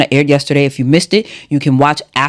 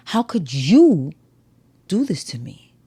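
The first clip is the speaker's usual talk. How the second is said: "you" held and stressed, slow delivery; accusing weight on "you"; 3 semitones higher, 5 dB quieter, slower than usual. Shown as a demonstration. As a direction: linger on "you", pause, then finish. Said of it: "when altering the emphasis on a word".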